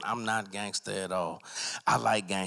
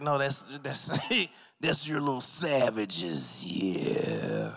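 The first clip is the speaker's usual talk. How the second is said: slow, raised wrestler drawl; Macho Man Randy Savage; 3 semitones higher, much slower than usual. Retold or imitated. [name] still doing the wrestler, hammed-up promo. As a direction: keep the promo voice going. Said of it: "imitating Macho Man Randy Savage".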